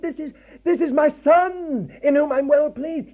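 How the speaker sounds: God can't contain himself for joy; overjoyed. Retold or imitated. God proclaiming his Son at the Jordan, overjoyed. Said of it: "God almost fell over himself".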